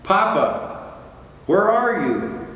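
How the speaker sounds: high calling little whale, scared; frightened cry. Acted. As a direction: call out lost in the dark. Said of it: "cried Little Blue".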